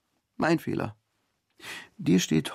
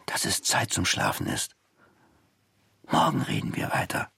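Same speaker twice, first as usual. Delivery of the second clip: hushed whisper in the sleeping bag. Acted. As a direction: whisper so nobody hears. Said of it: "Sie unterhielten sich leise". (geflüstert, ganz leise)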